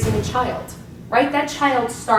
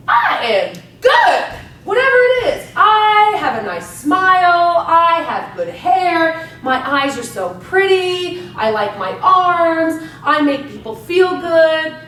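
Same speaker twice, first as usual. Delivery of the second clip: higher, louder and slow, proudly declaring each line; a coach showing a mirror affirmation, proud and loud; 6 semitones higher, 6 dB louder, slower than usual. Shown as a demonstration. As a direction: hype yourself up in the mirror, slowly and loudly. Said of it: "Get in front of the mirror and say".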